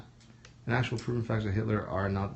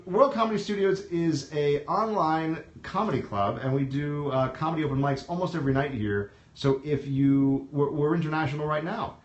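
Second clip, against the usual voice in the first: announcer plug; 4 semitones higher than usual. Acted. Plugs the show to viewers.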